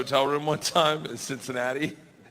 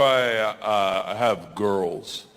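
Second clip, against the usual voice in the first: awkward hesitation; 7 semitones lower, much slower than usual. Retold or imitated. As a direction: lower and slow, halting. His awkward reply to the woman.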